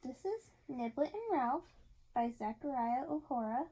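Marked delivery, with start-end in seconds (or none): none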